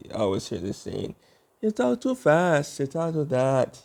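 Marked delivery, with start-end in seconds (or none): 1.6-3.8 high-pitched, drawn-out mock complaint; imitated complaint. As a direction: mimic people grumbling, pitched well up. Others complain he talks fast.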